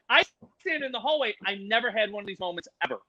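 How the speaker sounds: ordinary delivery, nothing marked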